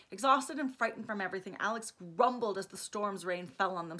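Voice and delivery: usual speaking voice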